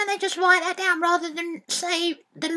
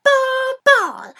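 mocking echo; 6 semitones higher, 8 dB louder than usual. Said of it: "No sheep goes baa".

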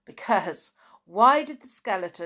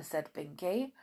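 ordinary delivery, nothing marked

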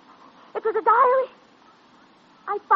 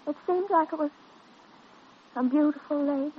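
dazed; 3 semitones lower, 6 dB quieter than usual. Acted. Dazed girl to the doctor, soft and faraway. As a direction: drift as if half remembering.

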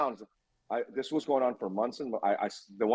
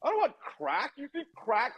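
a pitched-up retold panic of refusal; alarmed protest; 12 semitones higher than usual. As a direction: recoil at the offer of crack. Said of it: "And I'm like".

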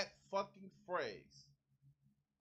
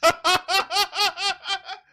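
loud burst of high laughter; 11 semitones higher, 18 dB louder than usual. Real, not acted. Streamer laughing out loud at a chat message, loud laugh.

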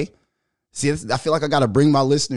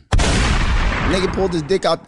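slow, higher, stressing "dick"; drawn-out punchline; 5 semitones higher, 3 dB louder, slower than usual. Real, not acted. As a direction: lean hard into "dick". Emphatic punchline.

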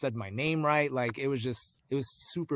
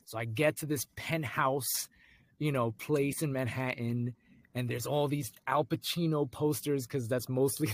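usual speaking voice

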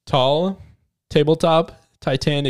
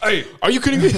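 high, laughing tough-guy outburst; annoyed jock; 10 semitones higher, 4 dB louder, much faster than usual. Retold or imitated. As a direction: blurt it out like a startled meathead. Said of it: "Soren would be like".

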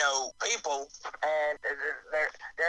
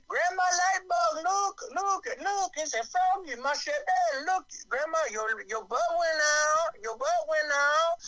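much higher, slow, squeaky toothless critter; firefly voice; 11 semitones higher than usual. Retold or imitated. The firefly introducing the frogs to Grandma, high and goofy. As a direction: play a goofy excited bug showing off his family. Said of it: "He sounds like me".

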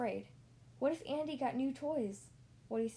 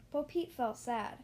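ordinary delivery

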